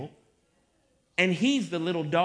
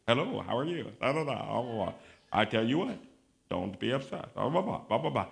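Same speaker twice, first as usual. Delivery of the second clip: low, soft, mock-pious drone; fake movie Jesus; 8 semitones lower, 3 dB quieter than usual. Preacher mocking Jesus in the movies, droning fake piety. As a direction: mumble along like a limp screen Jesus. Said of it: "Get that fake belly aching mess".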